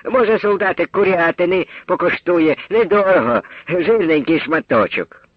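high, honeyed seller's offer, slowing at the end; sweetly offering. A narrator's reading. Market woman sweetly offering chicken to the soldier. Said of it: "люб'язно запропонувала".

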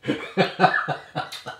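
loud breathy giggle; laugh. Real, not acted. Laughing at partner's love for the dog. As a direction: laugh before speaking.

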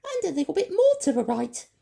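very high, loud, quick squeak; fast high creature. A narrator's reading. Creature giving directions, quick and high. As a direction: rattle off the directions.

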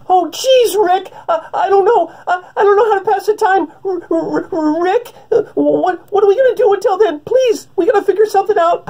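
very high, panicky stammering voice near tears; whiny, trembling nervous kid; Morty impression. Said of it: "yelling at Morty".

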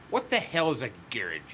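ordinary talk

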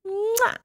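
high playful kiss sound. Acted. Mrs. Claus kissing her partner again. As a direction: plant a big noisy kiss.

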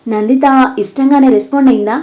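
ordinary delivery, nothing marked

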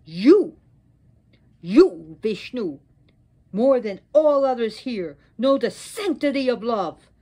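loud, outraged accusation; angry. Acted. Goddess turns on Vishnu for defending Brahma, angry. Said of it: "turned her angry eyes to Vishnu".

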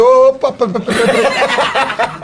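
high-pitched hurried "hold on"; retold plea to stop, pitched high; urgent interjection. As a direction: step in to halt a mock scuffle, voice jumping up. Breaking up a retold fight.

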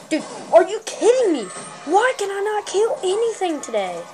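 loud, high-pitched exasperation; frustrated outburst. Real, not acted. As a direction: complain loudly at the game. Frustrated at failing to kill.

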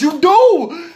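high, loud "You do!"; insistent cry. Real, not acted. Shopper insisting to viewers about adding prices, loud insistence. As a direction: burst out high, stretching the word "do".

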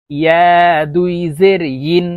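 ইয়া drawn out with a y glide, ইন as yin; clear ya, not hamza. Shown as a demonstration. Teacher correcting learners, ইয়া and ইন with a y, no glottal catch. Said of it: "চারটি হরফের উচ্চারণ চারভাবে হবে".

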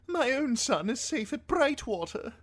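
high-pitched highborn lord; Lord Alistair. A narrator's reading. Lord tells Davos of his own family, self-absorbed. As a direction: speak in a high aristocratic voice.

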